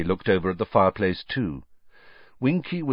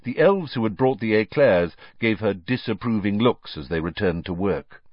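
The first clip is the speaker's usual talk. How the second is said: usual speaking voice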